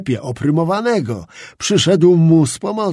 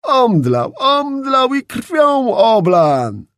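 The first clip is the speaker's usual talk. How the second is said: much higher, loud, slow mocking cry; shouting; 10 semitones higher, 5 dB louder, much slower than usual. A narrator's reading. Onlookers at the door shouting about Czapliński, high mocking cry. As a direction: holler the news gleefully. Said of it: "krzyczeli inni".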